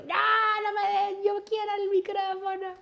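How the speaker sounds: put-on high-pitched childish whine; crying child. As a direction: wail like a crying little girl. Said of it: "yo así llorando".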